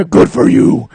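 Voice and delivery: very loud raspy superhero growl; hammed-up gravel-throated Batman. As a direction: roar it hoarsely as Batman.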